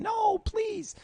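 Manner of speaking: high, whiny pleading; mock pleading whine. Voicing his own weakness mid-workout, high whine. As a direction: play the weak voice begging to quit. Voicing the body begging to stop.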